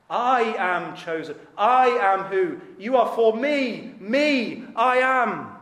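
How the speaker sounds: loud, high, stretched-out emphasis on I and me; mockingly triumphant, hammering every "me"; mock self-exalting declaration. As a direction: belt out each self-reference in parody. Mocks self-centred song lyrics, emphasising I, me.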